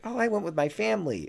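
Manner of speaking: high put-on voice; mock tourist reply. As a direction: answer sheepishly in a silly high voice.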